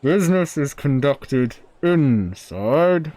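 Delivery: booming, drawn-out character voice; gruff forge dweller. Acted. Forge dweller summoning a visitor inside, slow and loud.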